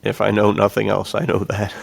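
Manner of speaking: ordinary talk, nothing marked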